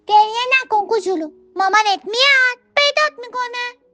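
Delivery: high, loud soothing; comforting ladybug. Acted. Ladybug comforts the lost ant, high and soothing. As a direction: reassure the crying little one.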